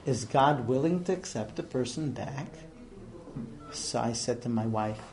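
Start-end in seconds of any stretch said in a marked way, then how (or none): 0.1-2.5 lightly imitated deep voice, kept quiet. Huge Russian stranger asks the rabbi, low and quiet. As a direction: ask it low and soft as the stranger. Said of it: "he has this deep voice".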